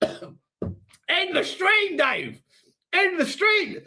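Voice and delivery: high, drawn-out choked plea; mock-desperate, long stretched words; strained mock plea. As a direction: squeeze it out high as if the throat has gone. Strained mock plea after coughing fit.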